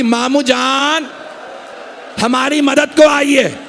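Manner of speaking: high, slow, drawn-out call; desperate cry for help. Acted. A dying boy calling his uncle for help, high slow cry. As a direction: cry out to the uncle across the battlefield.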